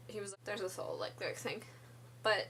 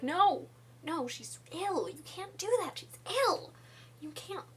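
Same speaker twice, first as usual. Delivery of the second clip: mock warning; 7 semitones higher than usual. Townsfolk warning, voiced high.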